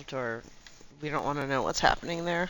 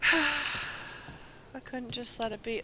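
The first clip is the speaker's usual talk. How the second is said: slow, sighing, higher confession; slow sighing admission; 6 semitones higher, much slower than usual. Real, not acted. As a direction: drag it out with a rueful sigh. Sighing confession about outfit.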